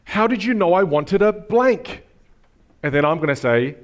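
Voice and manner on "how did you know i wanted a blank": gushing, high and drawn-out; delighted surprise. Shown as a demonstration. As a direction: react thrilled to the gift, stretching words out. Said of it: "and you go".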